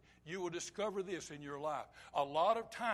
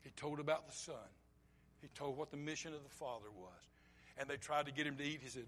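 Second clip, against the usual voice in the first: ordinary delivery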